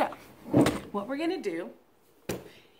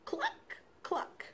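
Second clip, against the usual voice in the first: chicken cluck; 11 semitones higher, 9 dB quieter than usual. Shown as a demonstration. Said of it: "chickens sound like this".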